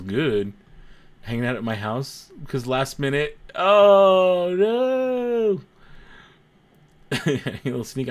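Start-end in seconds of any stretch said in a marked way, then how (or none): 3.5-5.6 long, high, loud wail; mock dismay. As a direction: draw it out in hammed-up dismay. Mock dismay at last-minute hangout.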